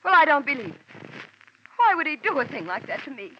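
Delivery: high, loud, tearful melodrama pleading; milk the grief like a soap opera heroine; soap opera hysterics. Acted. Widow hams up her grief to send the detective away, high and loud. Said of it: "that lather she'd gotten into was strictly from soap opera".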